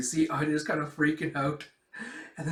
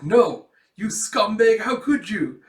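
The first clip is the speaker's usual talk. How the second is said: slow, loud, raised-pitch accusation; mock outrage; 7 semitones higher, 9 dB louder, slower than usual. Accusing someone of betrayal, mock-outraged. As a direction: draw out the outrage, hammed up.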